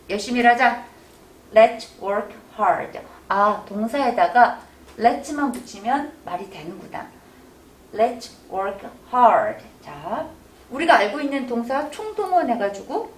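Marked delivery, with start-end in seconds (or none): 1.5-3.0 slow model read. Shown as a demonstration. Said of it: "다 같이". 7.9-9.6 slow model read. Shown as a demonstration. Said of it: "다 같이".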